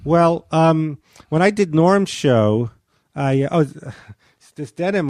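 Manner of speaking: normal talking voice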